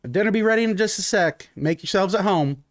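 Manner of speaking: lightly voiced cook calling to guests; hostess calling out. Urena calls from the kitchen.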